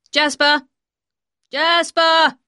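loud, high call for a lost dog; drawn-out shouted name. Acted. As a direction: call the dog from across a beach. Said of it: "called Maxim".

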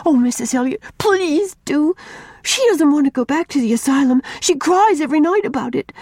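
put-on a small girl pleading for her friend; pleading little girl. A narrator's reading. Una pleads with Miss Cornelia for Mary, earnest child.

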